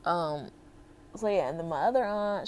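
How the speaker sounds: ordinary talk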